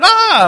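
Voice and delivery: very high, loud "ah"; sudden high yelp of alarm; wife's shriek. Retold or imitated. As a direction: imitate a startled shriek. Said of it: "my wife shrieks again".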